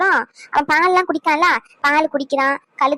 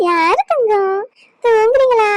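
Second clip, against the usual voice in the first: higher, louder sing-song coo; baby talk; 5 semitones higher, 4 dB louder, much slower than usual. Acted. Grandmother asking the baby if he is sleepy, high sing-song coo. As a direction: lift the voice up sweetly for the baby.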